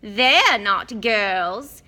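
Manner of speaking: drawn-out wail of a prim ghost; ghost girl Myrtle. Acted. Myrtle suspicious of boys.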